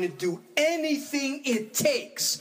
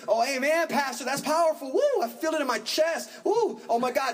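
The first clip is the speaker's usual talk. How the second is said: gushing churchgoer; 8 semitones higher than usual. Retold or imitated.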